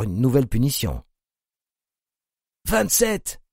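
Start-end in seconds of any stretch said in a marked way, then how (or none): 2.7-3.4 high, harsh barked number; belched out. A narrator's reading. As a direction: bark the number at her. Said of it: "éructa le tortionnaire".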